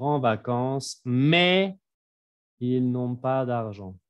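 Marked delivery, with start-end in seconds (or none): none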